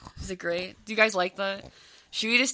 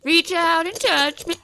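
higher, louder, slow and grand; dramatic pug voice; 7 semitones higher, 7 dB louder, slower than usual. Pretend. Owner voicing the pug shoving his paw in faces. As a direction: offer the crusty paw like a seductive star. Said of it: "He's like".

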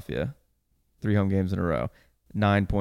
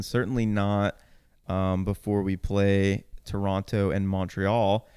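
ordinary talk, nothing marked

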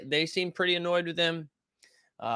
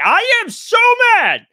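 much higher, much louder, slow hammed-up fury; over-the-top fake-angry TV analyst; mock TV outrage; 13 dB louder than usual. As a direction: parody a pundit acting mad on TV. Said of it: "he's like".

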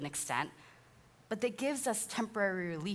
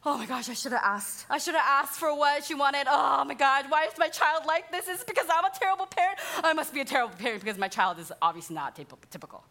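higher, louder, rushing mock panic; panicked self-blame; 6 semitones higher, 6 dB louder than usual. A mother blaming herself as her toddler cries, in hammed-up panic. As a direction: spiral into guilt, faster and faster. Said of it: "Number two, shame".

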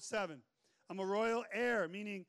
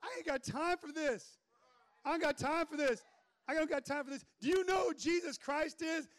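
higher, emphatic mock refusal; acted brush-off; 6 semitones higher than usual. Student rebuffing the man in the truck, high emphatic brush-off. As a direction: build from refusal to a raised challenge. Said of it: "You'd be like".

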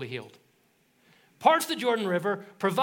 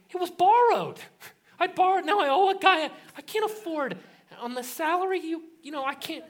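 frantic; 8 semitones higher than usual. Retold or imitated. Prophet begging Elisha about the borrowed axe, frantic and high. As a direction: spill it out in a rush of worry.

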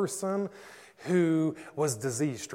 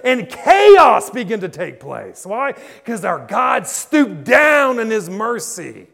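loud, much higher, building preacher's cry that slows at the end; full-force preaching; 9 semitones higher, 19 dB louder than usual. Acted. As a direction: roar the miracle story to the congregation.

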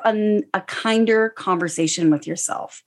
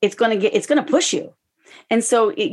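ordinary talk, nothing marked

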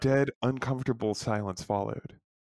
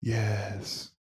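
cryptic alien; 3 semitones lower, 4 dB quieter than usual. Acted. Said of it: "overlapped wind chimes responded with a cryptic".

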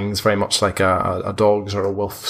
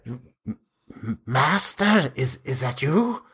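higher, stammering frightened thrall; a starved vampire creeping to his master; timid stammering servant; 4 semitones higher, 3 dB quieter than usual. Acted. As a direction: quaver it out like a cowering creature. GM voices the vampire spawn.

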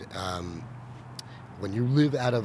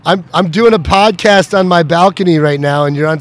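loud and higher into speakerphone; 8 semitones higher, 19 dB louder than usual. Real, not acted. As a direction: project to the phone.

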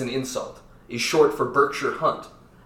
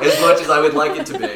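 ordinary delivery

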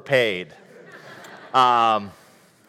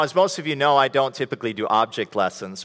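normal talking voice

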